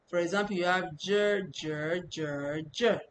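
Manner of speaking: "J" said in four tones, one after another; four Chinese tones. Shown as a demonstration. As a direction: run "J" through the four tones. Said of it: "Which one represents this character?".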